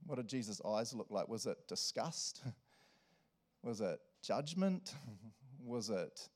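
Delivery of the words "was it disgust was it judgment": usual speaking voice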